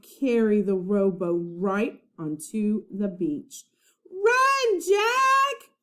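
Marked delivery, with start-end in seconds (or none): 4.1-5.7 high loud crying shout. A narrator's reading. Annie shouting a warning to Jack, loud crying shout. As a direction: scream a warning. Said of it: "shouted Annie".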